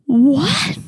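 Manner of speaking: loud, high outraged what; stunned. Real, not acted. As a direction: disbelief at what he just said. Reacting to George's excuse.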